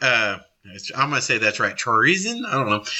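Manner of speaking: ordinary talk, nothing marked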